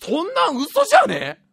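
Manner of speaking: slow, high, loud mock scoff; loud scoffing doubt. Mimicking a doubter who loudly calls the theory a lie, scoffing. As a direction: sneer it out like a know-it-all doubter. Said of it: "それを大声で言ってどうする？".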